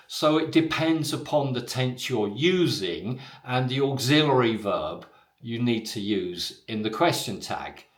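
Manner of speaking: ordinary talk, nothing marked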